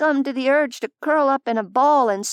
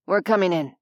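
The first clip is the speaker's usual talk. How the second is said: lower, firm official warning; stern manager voice; 4 semitones lower, faster than usual. Acted. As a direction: threaten politely through the door. Manager announces entry.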